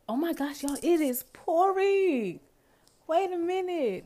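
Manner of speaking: loud, high cry of disbelief; real astonished outburst at a downpour; startled exclamation. Real, not acted. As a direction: stretch the key word out in shock. Sudden downpour interrupts her story.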